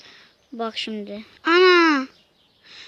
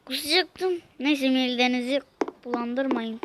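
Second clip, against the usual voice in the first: ordinary talk, nothing marked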